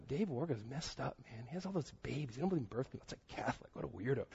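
hushed gossip, half under the breath; bad-mouthing. Young man bad-mouthing a friend behind his back, hushed griping. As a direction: imitate griping behind someone's back, very softly. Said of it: "And I started bad-mouthing him".

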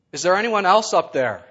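loud, high yell up at the sky; comic yell. Acted. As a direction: shout it to heaven in panic. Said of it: "looked up at the heavens and yelled".

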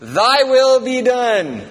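high, loud, slowly drawn-out declaration; booming prayer. Acted. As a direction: proclaim it slowly at high pitch and volume. Booming prayer line before quieter repeat.

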